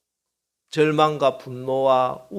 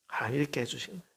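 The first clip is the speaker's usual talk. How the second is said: ordinary delivery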